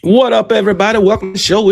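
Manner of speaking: booming hype welcome; loud greeting. Real, not acted. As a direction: call it out big to the crowd. Live show opening greeting.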